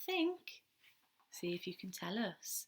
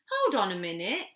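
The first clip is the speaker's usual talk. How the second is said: louder, firm objection; mild protest; 8 dB louder than usual. Acted. Baby Owl stops the chicks climbing on, protesting. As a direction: stop them, a little put out. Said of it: "protested Baby Owl".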